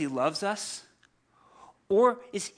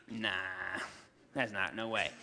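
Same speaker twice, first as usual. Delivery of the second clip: low, soft scoffing brush-off; dismissive; 9 semitones lower, 8 dB quieter than usual. Retold or imitated. Praying friends brushing off Rhoda, lightly dismissive. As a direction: wave the idea away.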